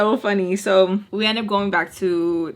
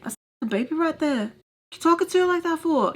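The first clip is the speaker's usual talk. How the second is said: higher-pitched mock challenge; imitated protective scolding; 8 semitones higher than usual. Man defends the baby, imitated indignant challenge. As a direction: play him sticking up for her, pitch climbing.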